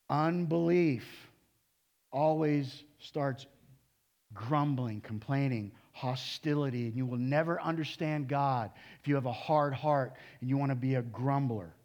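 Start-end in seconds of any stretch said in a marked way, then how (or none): none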